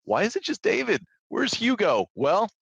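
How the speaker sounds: announcer intro. Acted. Host announcer opening intro.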